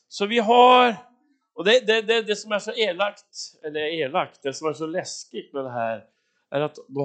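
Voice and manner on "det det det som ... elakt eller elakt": usual speaking voice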